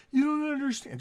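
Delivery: high-pitched mock drunken protest; put-on high drunk voice; drunk woman. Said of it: "that drunk".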